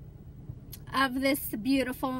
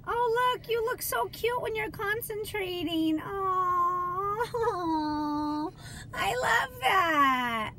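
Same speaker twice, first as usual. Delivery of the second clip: higher, gushing and adoring; 5 semitones higher, slower than usual. Real, not acted. Mom cooing at her son doing her nails. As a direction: coo over him like a proud mom.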